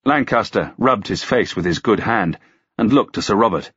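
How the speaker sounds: ordinary delivery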